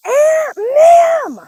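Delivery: put-on very high, loud child's shout across the store; high excited kid calling across a shop; little girl shouting. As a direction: yell out to her aunt like a thrilled five-year-old. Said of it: "like, goes".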